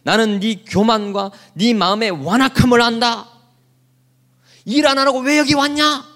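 mock furious rebuke of the youngest; angry brother. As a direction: Eliab berating David, hammed-up anger. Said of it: "화를 내는 거예요".

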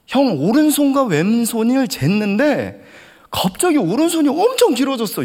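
high, slowish, loud shout; yelling hoobae. Retold or imitated. Junior yelling the news to his older brother, high loud shout. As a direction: shout the wonder at him. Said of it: "고래고래 소리를 지르더라고요".

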